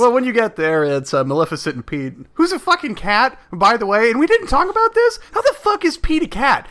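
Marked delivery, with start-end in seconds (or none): 2.3-6.7 much higher, exasperated disbelief; incredulous rant. Real, not acted. Friend ranting about cartoon cat Pete, high incredulous. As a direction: throw up your hands at it. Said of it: "ranting about Pete being cat".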